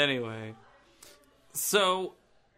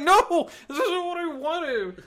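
whiny complaint; 8 dB louder, slower than usual. Retold or imitated. Mock quote of disappointed son.

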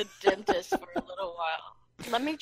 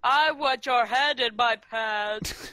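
slow, thick-tongued caricature voice; mock impaired speech; 4 dB louder, slower than usual. Mocking a random girl's proposition, slow caricature voice. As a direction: drag the words in a clumsy put-on voice. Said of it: "why does she have Down syndrome now".

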